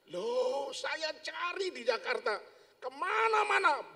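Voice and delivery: high, loud overjoyed greeting; nearly shouting with joy; excited. Acted. Old friend excitedly telling the man he searched everywhere.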